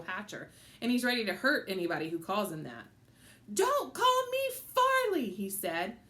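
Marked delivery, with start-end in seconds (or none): 3.5-5.3 put-on little boy, high and insistent; squeaky kid voice, slow and firm; high child voice. A narrator's reading.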